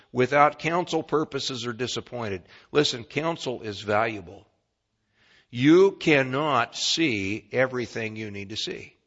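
usual speaking voice

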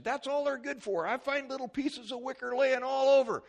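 loud, high exasperated rant; retold griping. Husband giving wife trouble over laundry baskets, exasperated. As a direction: complain hotly, pitch way up. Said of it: "giving her all kind of trouble".

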